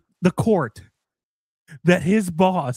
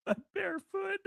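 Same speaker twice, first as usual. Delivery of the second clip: mock high squeak; 14 semitones higher, 12 dB quieter than usual. Mocking the phrase in high voice.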